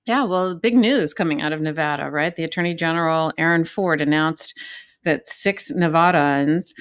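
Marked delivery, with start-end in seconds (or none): none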